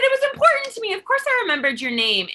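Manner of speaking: high, loud earnest reassurance; eager reassurance. Retold or imitated. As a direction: insist it mattered to you. Retelling her answer.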